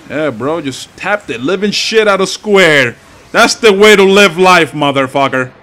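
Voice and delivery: real gamer rush, drag the words out loud; hyped yelling. Real, not acted. Gamer mashing Square, yelling in real excitement.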